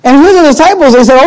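usual speaking voice

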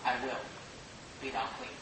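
very low, hushed healing words; hushed and tender, like a blessing; tender Jesus. Acted. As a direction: speak it softly, barely above a breath. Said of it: "But for Jesus to say".

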